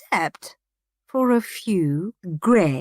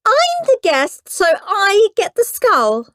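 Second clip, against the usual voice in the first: bossy; 12 semitones higher, 7 dB louder than usual. Acted. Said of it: "said Moody Margaret".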